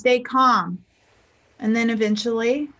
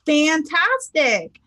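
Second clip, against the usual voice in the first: higher, drawn-out cheery exclamation; upbeat greeting; 5 semitones higher, much slower than usual. Shown as a demonstration. Coach demonstrating an answer to how are you, very upbeat. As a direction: stretch it out with big enthusiasm. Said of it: "say something really great".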